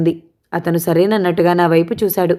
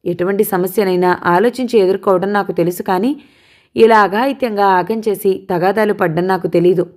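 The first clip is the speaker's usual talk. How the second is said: plain reading voice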